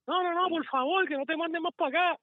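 high, put-on pleading; pleading store worker. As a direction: beg them not to send him again. Retold store worker begging. (súplica aguda imitando al trabajador)

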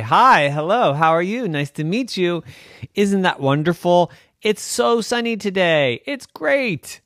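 chirpy, rising in pitch; mock cheerful. Ghost showing how ghosts really talk, exaggeratedly cheerful. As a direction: pile on sugary pleasantries.

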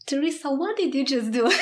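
amazed, laughing disbelief; overwhelmed surprise. Real, not acted. Moved host reacts to guest.

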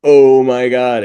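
high, loud burst of awe; awestruck exclamation. Retold or imitated. Awards viewer reacting to a stunning song, awestruck exclamation. Reacting to a mind-blowing performance.

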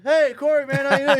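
high, loud, excited hello; mock hearty greeting. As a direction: act out a guard hailing a pal. Security guard's hearty greeting acted out.

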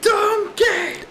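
playful shout. Real, not acted. Teasing hiccuping friend, calling out.

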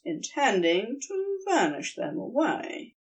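plain reading voice

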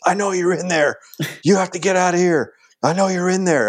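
high-pitched mock yell through a door; angry building manager. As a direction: imitate a manager demanding they get out. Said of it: "banging on the door with his fist".

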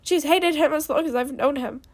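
loud, heated follow-on; yelled accusation. A narrator's reading. Knowledge telling of her sister's hatred, yelled. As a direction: keep the blame coming, loud. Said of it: "she yelled".

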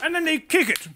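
high, laughing mock colonial voice; hammed-up posh English colonial mocking the game; posh colonial Brit. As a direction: sneer at the natives like an old colonial. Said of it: "I don't say sepak takraw".

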